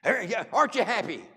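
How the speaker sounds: high, loud boastful crowing; hammed-up gloating to the whole town; mock bragging shout. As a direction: shout it like a show-off announcing himself. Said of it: "I'd be going through".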